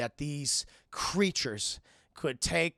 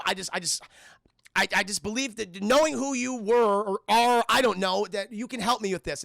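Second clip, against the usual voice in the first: raised, louder, earnest appeal; urgent plea; 5 semitones higher, 7 dB louder than usual. Acted. Terry pleading over speaker system for help, urgent. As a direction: plead your case insistently.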